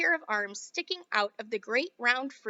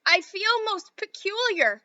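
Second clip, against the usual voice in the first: put-on gasping girl; 9 semitones higher, 6 dB louder, slower than usual. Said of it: "gasped Violet".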